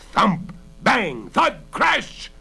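loud, high, punched sound words; dramatic crashing exclamations; battering noises. A narrator's reading. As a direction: hit each word like a blow. Narrator voicing blows on the door.

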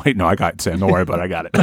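ordinary talk

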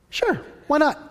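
high-pitched, breezy yes; breezy agreement. Retold or imitated. Giver airily answers the woman at the cash box, breezy.

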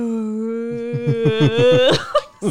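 loud bright burst of laughter. Real, not acted. Laughing at own joke.

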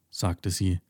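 ordinary delivery, nothing marked

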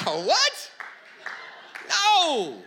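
mock shock. Mock outrage at Jesus' reply.

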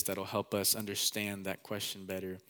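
ordinary talk, nothing marked

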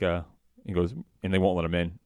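ordinary talk, nothing marked

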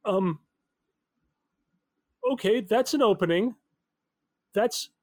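normal talking voice

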